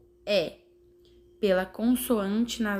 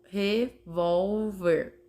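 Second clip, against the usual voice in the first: "revólver" slowly split into syllables; much slower than usual. Shown as a demonstration. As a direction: count out "revólver" one piece at a time. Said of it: "consigam contar as sílabas".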